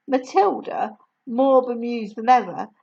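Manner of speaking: usual speaking voice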